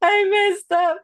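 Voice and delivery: very high, hammed-up weepy confession; mock tearful. Wife confessing to her husband, mock tearful. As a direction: imitate yourself wailing, half laughing at it. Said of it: "And I started crying".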